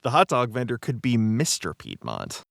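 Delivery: ordinary talk, nothing marked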